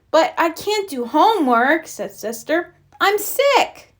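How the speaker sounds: put-on whiny child. A narrator's reading. Sister whines sick.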